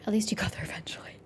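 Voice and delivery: a dry sarcastic whisper; whispered jab. Real, not acted. Player needling the soldier, in a snide whisper. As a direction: breathe it as a sly aside.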